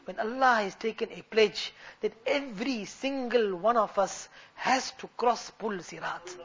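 very soft, grave retelling; subdued, awed voice of a retold believer; hushed solemn answer. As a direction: drop right down and confide it solemnly. Retold answer of weeping companion.

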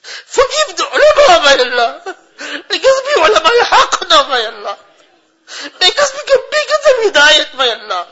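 high, crying prayer; real sobbing supplication, voice pushed high; tearful pleading. Real, not acted. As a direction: weep as you beg God for forgiveness. Weeping supplication for the suffering.

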